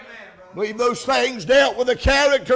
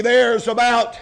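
ordinary talk

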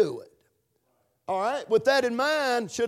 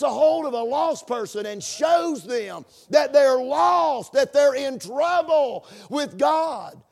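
raised, impassioned pulpit delivery; fervent preaching; 3 semitones higher, 3 dB louder than usual. Real, not acted. Preacher urging the church about lost sinners, fervently.

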